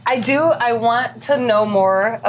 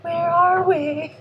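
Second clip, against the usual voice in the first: high, slow lost-ghost plea; 10 semitones higher, much slower than usual. Pretend. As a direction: plead like a trapped spirit. Pretending to be a ghost.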